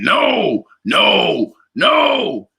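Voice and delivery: high mock cries of no; retold screaming. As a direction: imitate a homeless man wailing. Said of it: "somebody was screaming".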